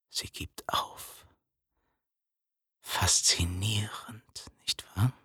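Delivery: slow, voiceless whisper. Acted. Narrator to listener about the spider, whispered. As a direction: breathe it out, barely voiced and slow.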